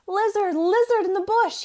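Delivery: high loud hurried call; out-of-breath mouse calling the lizard; urgent call. A narrator's reading. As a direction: call out to the bush in a rush. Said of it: "he called quickly".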